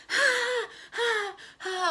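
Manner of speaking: high, drawn-out fake "ha"s; annoying mock laugh. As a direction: imitate an obnoxious group laugh. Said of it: "like, annoyingly laughing about everything".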